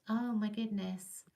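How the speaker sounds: ordinary talk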